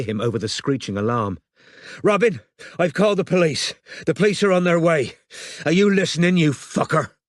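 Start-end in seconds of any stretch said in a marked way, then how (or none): 2.0-7.1 desperate shouting down the phone. Acted. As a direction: shout to be heard over an alarm. Shouting over alarm into phone.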